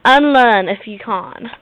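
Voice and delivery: loud, slow British put-down; hammed-up British diva retort; British accent. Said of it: "talk like a freaking U K".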